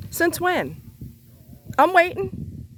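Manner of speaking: high, heated demanding; angry. Real, not acted. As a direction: challenge them, furious and high. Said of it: "I'm cussing 'cause I'm angry".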